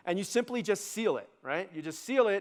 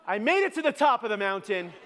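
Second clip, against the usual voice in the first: higher, comically wounded cry; mock indignant boast; 5 semitones higher, 4 dB louder than usual. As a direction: protest the snub in a raised voice. Comic outrage at being snubbed.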